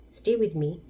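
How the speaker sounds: ordinary talk, nothing marked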